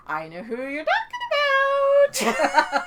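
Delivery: very high, loud, slow sing-song teasing; sing-songy voice. Retold or imitated. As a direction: lilt it like a knowing tease. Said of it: "in this little sing-songy voice she does".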